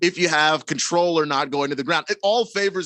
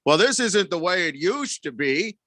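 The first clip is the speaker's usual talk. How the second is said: lightly voiced old-timer, pitched up; old man; 4 semitones higher than usual. Co-host mocking an aging quarterback, lightly voiced old man. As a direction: complain with a hint of old man. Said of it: "goes on like that old man".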